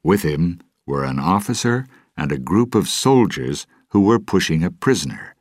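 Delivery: ordinary delivery, nothing marked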